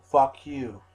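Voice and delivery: shouted retort. Retold or imitated. Shouting back at critics, full yell. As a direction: yell it right at them. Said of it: "I say".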